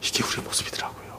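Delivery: hushed confession; whisper. Real, not acted. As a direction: whisper it as a quiet admission. Confessing after child voice. (속삭이듯 고백하며)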